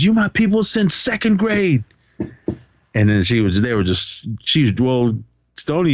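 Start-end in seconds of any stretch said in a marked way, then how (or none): 0.0-1.8 high, put-on voice of a drunk friend; hammed-up loyal plea, pitched way up; imitating a friend pleading loyalty; mock heartfelt plea. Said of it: "He goes, he goes".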